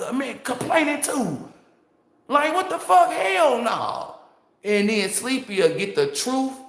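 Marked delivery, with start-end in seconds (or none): 2.3-4.2 indignant outburst. Retold or imitated. Venting to Sleepy about the lazy coworker, indignant outburst. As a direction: scoff it like you can't believe the nerve.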